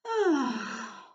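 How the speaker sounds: demonstrative big "oh" sigh; loud sigh. Shown as a demonstration. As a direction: let all the air out loudly. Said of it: "If I go like this".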